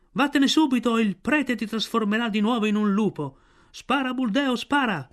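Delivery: high-pitched mob cries; crowd shouting. A narrator's reading. Villagers threaten the sorcerer and urge Buldeo on, shouting. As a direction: cry out urgently from the crowd. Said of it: "la gente prese a gridare".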